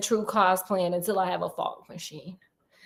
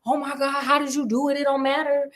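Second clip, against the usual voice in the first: higher, gushing praise; a king overjoyed at the news; excited king; 4 semitones higher than usual. Retold or imitated. King praising Holmes, gushing excitement. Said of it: "he's like".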